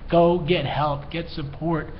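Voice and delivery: ordinary delivery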